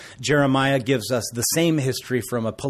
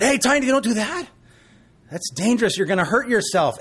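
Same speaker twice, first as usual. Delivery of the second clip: put-on raised, higher warning to a toddler; dad warning his son away from the socket, raised voice; calling out a warning; 9 semitones higher than usual. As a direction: call out sharply to stop him.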